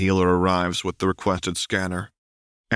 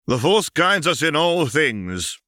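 tart retort; 9 semitones higher, 5 dB louder, slower than usual. Acted. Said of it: "Master Poof retorts tartly".

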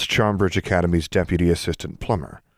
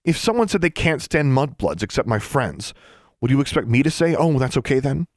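high, heated challenge; raised voice; 7 semitones higher than usual. A narrator's reading. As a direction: throw the question at him, voice up. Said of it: "Alexandra raised her voice".

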